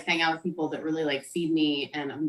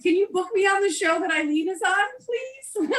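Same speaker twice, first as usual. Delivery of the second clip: pleading high voice; 12 semitones higher than usual. Retold or imitated. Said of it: "I was like".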